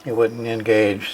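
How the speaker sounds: usual speaking voice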